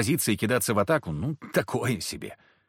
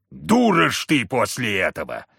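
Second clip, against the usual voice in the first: gruff, louder angry man; enraged husband; 4 dB louder than usual. A narrator's reading. Husband rages at his wife for chasing the boys off. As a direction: snarl at the wife.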